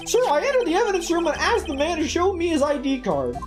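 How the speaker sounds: high, earnest rookie cop voice; eager young patrolman. Acted. Voices patrolman character in game.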